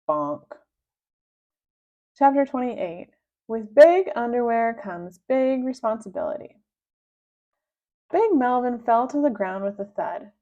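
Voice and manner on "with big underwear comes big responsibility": ordinary delivery, nothing marked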